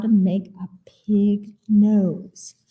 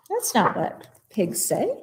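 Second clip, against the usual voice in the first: plain reading voice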